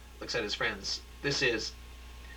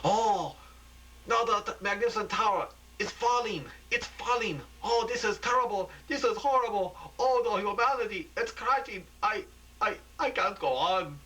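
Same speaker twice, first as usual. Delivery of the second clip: anguished reporting; 10 semitones higher than usual. Acted. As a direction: wail it as the tower falls. Reporter watches tower collapse.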